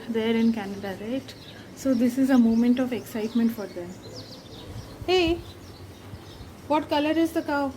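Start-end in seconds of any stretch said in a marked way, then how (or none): 5.1-7.7 high, quizzing motherly voice; talking to a toddler. Acted. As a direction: ask the little one gently. Mother quizzes daughter on cows.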